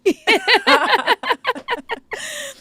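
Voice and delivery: a long laugh that starts high and falls. Real, not acted. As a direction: let the laugh ring out high and drop away. Host laughs at own joke.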